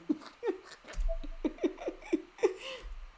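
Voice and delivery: high, breathy villain cackle; evil cackle. Acted. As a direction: cackle with glee.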